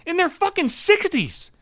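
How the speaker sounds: high, slow, drawn-out indignation; incredulous outrage. Acted. Comedian on being beaten by older runners, incredulous outrage.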